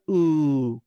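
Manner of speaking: "U" with lips pushed out; rounded u demo. Shown as a demonstration. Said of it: "redondeando los labios". ("U" con los labios redondeados)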